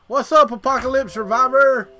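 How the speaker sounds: loud, high greeting to a viewer; excited shout-out. Real, not acted. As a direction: call out the viewer's name with a burst of excitement.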